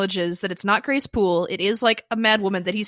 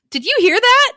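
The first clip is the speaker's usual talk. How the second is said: mock innocent; 12 semitones higher, 9 dB louder than usual. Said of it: "she just asks".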